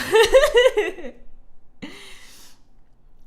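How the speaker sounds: big high laugh; loud laugh. Real, not acted. Reacting to chat, loud laugh. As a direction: burst out laughing.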